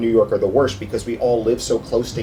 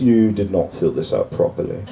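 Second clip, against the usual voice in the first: stern English clerk; 6 semitones lower than usual. Retold or imitated. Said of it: "the guy goes".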